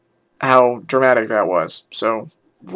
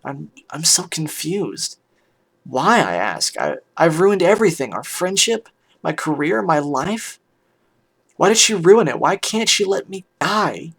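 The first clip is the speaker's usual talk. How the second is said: higher, swooping, distraught pleading; anguished; 4 semitones higher than usual. Acted. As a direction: plead in despair, pitch rising and falling sharply. Narrator voices her anguished confession.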